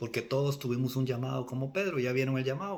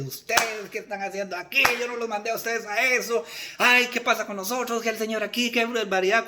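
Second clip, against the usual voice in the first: higher, louder acted reprimand; mock scolding; 6 semitones higher, 4 dB louder than usual. The Lord imagined scolding his disciples, in mock indignation. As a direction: play an indignant telling-off.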